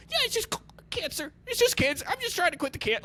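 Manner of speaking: very high, sing-song breezy voice; hammed-up chipper answer to the Uber driver; mock cheery reply. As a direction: brush off the worried driver brightly. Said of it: "And I was like".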